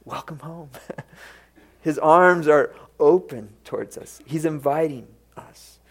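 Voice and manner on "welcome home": much softer, gentle welcome; soft tender welcome. Acted. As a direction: breathe it like a loving embrace. God welcomes the returning child.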